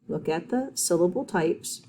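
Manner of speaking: ordinary talk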